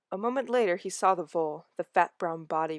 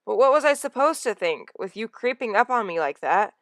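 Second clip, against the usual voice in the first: defensive; 5 semitones higher, 5 dB louder than usual. Acted. Firepaw defending himself.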